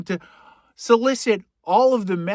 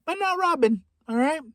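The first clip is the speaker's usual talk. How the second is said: comic high squeak; 10 semitones higher than usual. Acted. Comedian echoing his own joke to the audience in a high mock voice. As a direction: repeat it much higher, in a mock squeak. Repeats line with comic pitch jump.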